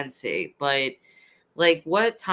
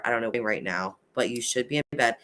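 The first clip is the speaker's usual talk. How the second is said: usual speaking voice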